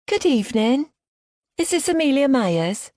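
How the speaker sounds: high, put-on Liverpudlian accent. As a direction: fake a Liverpool bank caller. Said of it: "I put on a strong Liverpudlian accent".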